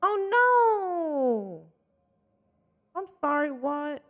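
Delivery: high, stunned gasp of disbelief; shocked disbelief. Real, not acted. Streamer reacting to a spoiled game ending, shocked disbelief. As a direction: voice leaps up in shock.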